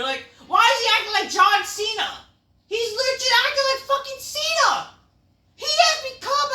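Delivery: high, loud exasperated yell; outraged shout. Real, not acted. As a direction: shriek your disbelief. Outraged complaint about Orton.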